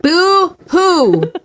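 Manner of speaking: a high mocking "boo-hoo"; sarcastic baby crying. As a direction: fake sob to mock her complaint. Mocking streamer's money complaint.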